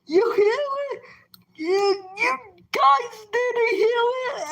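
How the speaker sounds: very high, mock-frightened questioning; imitating fearful sister. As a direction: mimic your sister's alarmed disbelief in a high voice. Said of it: "became real fearful".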